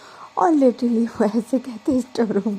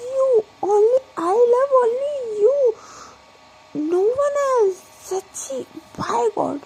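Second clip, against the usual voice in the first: very high, whiny, drawn-out pleading; tearful drunk vow; 13 semitones higher, slower than usual. Acted. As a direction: swear your love, half crying. Drunk Pragya swears her love.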